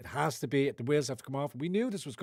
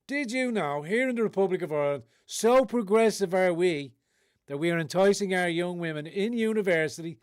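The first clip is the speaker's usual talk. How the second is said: mock progressive promo; 5 semitones higher, 5 dB louder than usual. Mocking progressive campus promo.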